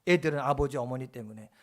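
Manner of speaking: ordinary talk, nothing marked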